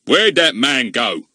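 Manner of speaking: slow, loud demanding policeman. Acted. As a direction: bark the question slowly. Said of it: "demanded Mister Goon".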